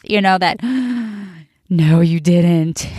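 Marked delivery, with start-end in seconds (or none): 0.7-1.3 sharp imitation gasp; scandalized mock gasp; mock shocked gasp. Held their breath, you know, that. 1.7-2.9 low, slow mock disbelief; hammed-up shocked reproach; mock scandalized. Voicing stunned onlookers after a gasp.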